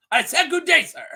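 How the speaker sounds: high, loud mock outburst; mock indignant dismissal. Host replays a son firing his mother, mock indignant shout.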